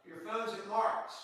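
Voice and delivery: put-on high, slow, soft old man on the line; faint old voice as if over the phone; grandpa's phone call. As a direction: play the confused grandpa, quietly. Voicing grandpa's confused phone call.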